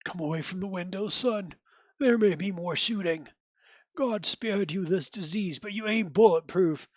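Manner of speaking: lightly voiced weary father, quiet; a hint of a tired dad warning his son softly; dying father. Dying dad calling son from the window, lightly voiced and quiet.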